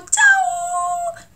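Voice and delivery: "chao" leaping high; bright high-rising Chilean "chao"; rising Chilean intonation. Shown as a demonstration. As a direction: toss "chao" up sharply. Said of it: "va para arriba".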